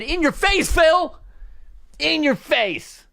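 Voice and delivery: high-pitched triumphant taunt; gloating shout. Acted. Dad showing off at career day, gloating shout.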